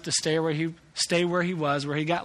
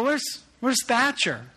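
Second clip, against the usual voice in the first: high, slow alarmed call; a dad suddenly missing a child; startled where-is-he question; 7 semitones higher, slower than usual. Retold or imitated. Father realizes son missing.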